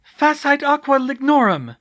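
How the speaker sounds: high, loud incantation; ringing shouted incantation; casting a spell. Acted. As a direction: cry out the spell with full force. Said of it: "and cast".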